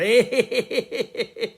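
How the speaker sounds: loud high-pitched cackle; high cackling laugh. Real, not acted. Vlogger cackling loud after calling himself crazy. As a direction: let out a shrill crazy cackle.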